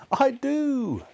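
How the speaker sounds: very high, fearful admission; frightened Scrooge. Acted. Scrooge admitting belief to the ghost, high and frightened. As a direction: blurt it in a high-pitched fright.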